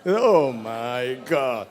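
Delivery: very slow, loud, stretched Oh my God; drawn-out exasperation. Shown as a demonstration. Speaker leading audience in the gesture, drawn-out exasperation. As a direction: groan each word out long, in mock despair. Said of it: "Vamos a hacerlo, el".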